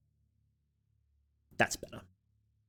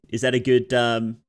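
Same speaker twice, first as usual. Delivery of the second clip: normal talking voice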